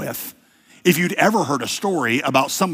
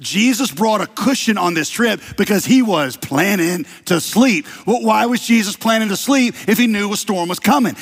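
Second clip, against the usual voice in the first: normal talking voice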